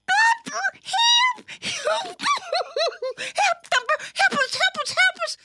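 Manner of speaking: put-on very high little-kid panic; frantic cry for help. A narrator's reading. Little sister calls Thumper to the rescue, frantic cry. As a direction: squeak out repeated pleas in alarm. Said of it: "cried one of the sisters".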